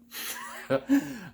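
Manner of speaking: sudden high squeaky giggle. Real, not acted. Host cracking up at his own rant, a high giggle. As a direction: break into a high giggle at yourself.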